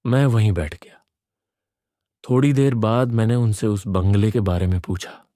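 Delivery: ordinary delivery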